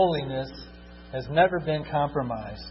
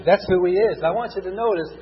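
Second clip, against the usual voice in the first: normal talking voice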